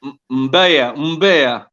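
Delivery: "Mbeya" said clearly with the m joined to the b, twice; correct pronunciation. Shown as a demonstration. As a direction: model the name "Mbeya" for a colleague. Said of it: "Help me out, guys".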